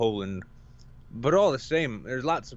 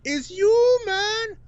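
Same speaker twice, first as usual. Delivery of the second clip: high, loud mock Caribbean greeting; Caribbean accent; 6 dB louder, much slower than usual. Weed dealer recognizing him, mock Caribbean accent. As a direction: call it out with a Caribbean lilt. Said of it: "thick Caribbean accent".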